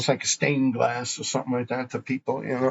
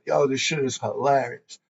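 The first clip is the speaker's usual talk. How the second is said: ordinary talk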